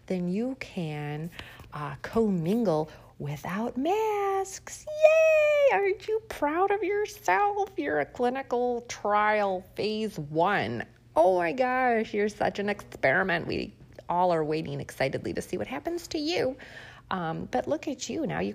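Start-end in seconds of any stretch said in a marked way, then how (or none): 1.7-4.9 sarcastic sing-song praise, high and slow; mock cheer. Host mocks vaccinated listeners with sarcastic high cheer. 4.9-5.8 shrieking sarcastic yay, much higher and louder; fake cheer. Host mocks vaccinated listeners with a sarcastic squealed yay. 5.8-16.6 sarcastic sing-song praise, high and slow; mock cheer. Host mocks vaccinated listeners with sarcastic high cheer.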